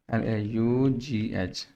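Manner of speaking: each letter spelled slowly; slow careful spelling for a pupil. Shown as a demonstration. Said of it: "लाफ की spelling".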